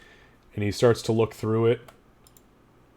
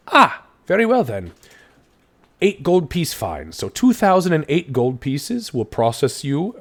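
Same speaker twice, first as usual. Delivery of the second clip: higher and louder, officious; brisk official; 5 semitones higher, 6 dB louder than usual. Acted. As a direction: announce the fine like a clerk. Constable declares the fine.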